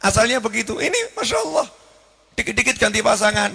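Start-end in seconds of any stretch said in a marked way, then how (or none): 0.8-1.7 exasperated exclamation. Real, not acted. Preacher exclaiming in dismay.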